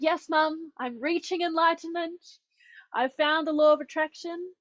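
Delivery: high, loud, acted-out announcement to mum; excited proclamation. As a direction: proclaim it brightly, pitched well up. Excited retort to mum about enlightenment.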